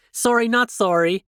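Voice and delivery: high, loud Canadian "sorry". Real, not acted. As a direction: sing out an unapologetic Canadian apology. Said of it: "The Canadian sorry".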